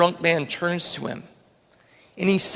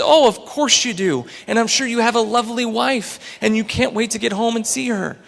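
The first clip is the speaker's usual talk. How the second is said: put-on high, loud, cheery old-man voice; gushing old man; 7 semitones higher, 6 dB louder than usual. A narrator's reading. Old man assuring a drunk about his wife, loud and gushing. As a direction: burst out eagerly, pitched well up.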